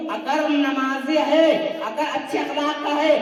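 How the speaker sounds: insistent rhythmic sermon argument; forceful preaching. Real, not acted. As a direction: drive each question home to the congregation. (ज़ोरदार तक़रीरी अंदाज़)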